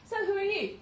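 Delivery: quick, pointed quizzing; retold doctor's question. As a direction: play the brusque junior doctor.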